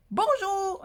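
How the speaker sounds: a high, sung-out, pointed "Bonjour". Retold or imitated. Answering the viewer who forgot hello, pointed sung bonjour. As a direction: answer a rude tip with a pointed greeting. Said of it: "j'ai répondu".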